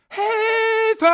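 long, piercingly high scream for help; drawn-out high-pitched yell; very loud cry. A narrator's reading. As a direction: shriek it like trapped children. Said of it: "Sie riefen ganz laut".

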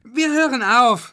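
put-on very high, loud kids' voice; children promising. A narrator's reading. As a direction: squeak it out like children caught misbehaving. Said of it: "sagten die Kinder".

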